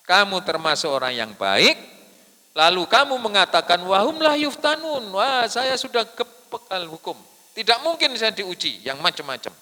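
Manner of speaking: loud, high sermon voice mocking complacency; impassioned preaching. Real, not acted. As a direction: challenge the congregation at full voice. Preaches, acting a complacent believer. (berkhotbah lantang, menantang jamaah)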